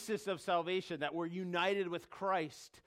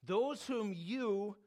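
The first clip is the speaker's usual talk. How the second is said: tender; 3 semitones higher than usual. Retold or imitated. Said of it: "What an amazing way".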